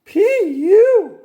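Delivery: high, loud disgusted cry; high, loud grossed-out girl. A narrator's reading. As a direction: wrinkle your nose at the smell. Frogalina smells aftershave.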